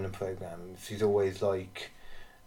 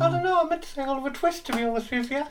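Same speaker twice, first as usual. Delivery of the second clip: squeaky falsetto; 4 dB louder than usual. Pretend. Answers in the same voice.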